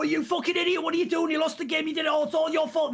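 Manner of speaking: imitating a raging coach berating his player; mock angry yelling. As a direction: rant at a player as if furious. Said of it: "he'd go".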